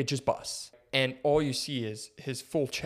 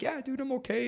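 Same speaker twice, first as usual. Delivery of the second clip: lightly voiced patient; 11 semitones higher, 6 dB quieter than usual. Patient's reply retold.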